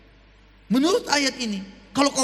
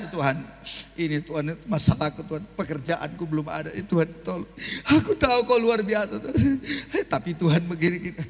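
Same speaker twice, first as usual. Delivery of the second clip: clingy doubting prayer; 6 semitones lower than usual. Shown as a demonstration. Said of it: "Bayangin Tuhan".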